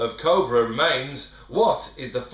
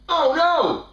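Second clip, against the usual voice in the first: much higher, louder oh no; hammed-up shock over nothing; mock dismay; 12 semitones higher, 6 dB louder than usual.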